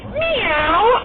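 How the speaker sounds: high, projected meow, not screamed; controlled yelled meow. Shown as a demonstration. Player showing her own cheer, controlled yelled meow. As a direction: yell it but keep the tone clean. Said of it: "yelling loudly while maintaining the quality".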